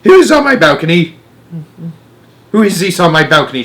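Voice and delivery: high, loud suspicious witch; witch's angry demand. A narrator's reading.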